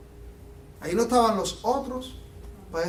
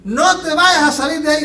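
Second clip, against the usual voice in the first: laughing, higher and louder joke voice; hammed-up Jesus holding Peter back; mock contrary Jesus; 6 semitones higher, 6 dB louder than usual. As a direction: tease the crowd with the wrong version of Jesus. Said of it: "Jesús le dice".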